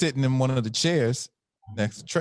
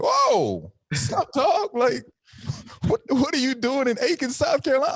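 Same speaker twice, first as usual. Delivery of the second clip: very high, thrilled whoa; seeing Eddie Murphy on set, very high excited; excited surprise. Retold or imitated. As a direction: squeal with disbelief at the star. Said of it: "I was like".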